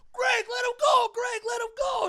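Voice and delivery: very high-pitched panicked yell; imitated urgent shouting. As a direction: shout to stop a friend mid-disaster. Onlookers shout at the bartender.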